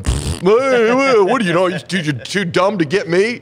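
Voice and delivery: high, loud, scoffing voice jeering at the audience; mocking heckle at crowd. Jaded comic on stage taunting a silent crowd, high and sneering. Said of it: "And then I'm up there going".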